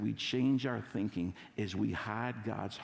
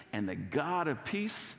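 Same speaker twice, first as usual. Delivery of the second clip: joyful recitation. Shown as a demonstration. Pastor has neighbours say it to each other, joyful. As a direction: lead it joyfully, pausing midway. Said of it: "gonna say this with joy".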